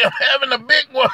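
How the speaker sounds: high-pitched mock heart-attack cry; imitated sitcom wail, pitched high; Fred Sanford. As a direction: play up a comic heart attack. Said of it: "Fred Sanford, you say".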